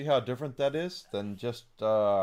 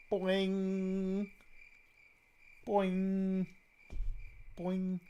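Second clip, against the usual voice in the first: sung-out higher cartoon boing; mock boing; 6 semitones higher, much slower than usual. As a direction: play it like a bounce sound effect. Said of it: "mock sound effect".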